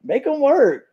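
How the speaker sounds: high, emphatic repeat of the demand; super excited. Retold or imitated. Fan retelling their glee at the new rule, super excited. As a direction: push the pitch right up with relish. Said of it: "super excited".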